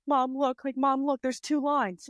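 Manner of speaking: bursting to show mom the two lines; excited. Retold or imitated.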